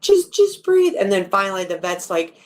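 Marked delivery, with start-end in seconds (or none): 0.0-1.0 high, louder desperate plea; imitating a frantic owner urging her dog; pleading with the dog. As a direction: beg the dog to breathe, panicking. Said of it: "you could hear it in her voice".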